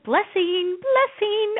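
high, rising singsong; hammed-up delight; joyful proclaiming. Mom proclaiming blessing over her home, joyful singsong. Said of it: "I was like".

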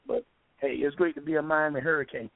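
normal talking voice

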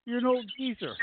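high, slow mocking tease; teasing. Real, not acted. Teasing friend about age.

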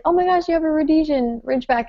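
high, fast gush; excited. Retold or imitated. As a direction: blurt it out thrilled. Excited breed recognition quote.

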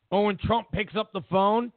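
ordinary delivery

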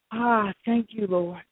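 usual speaking voice

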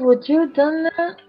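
ordinary delivery

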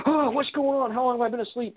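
high, startled and confused on waking; groggy alarm. Retold or imitated.

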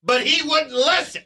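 high, slow, hammed-up frustration; exasperated wife. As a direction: imitate a wife insisting through her anger. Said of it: "That's what she said".

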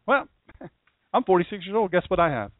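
imagined friend Chris, wry laugh, lightly voiced; friend answering honestly. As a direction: laugh it off, then say it straight. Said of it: "What if Chris says".

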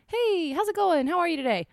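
high, bright chirpy hello; cheery greeting. Retold or imitated. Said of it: "whenever you say".

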